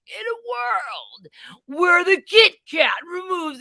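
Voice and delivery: slow, booming trailer narrator; movie trailer voice. Pretend. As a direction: epic voiceover announcer. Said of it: "I was kinda hoping for".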